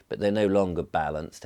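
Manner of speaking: ordinary talk, nothing marked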